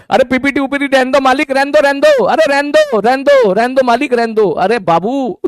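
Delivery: high whiny mock begging; pleading students. Students begging to skip the PPT.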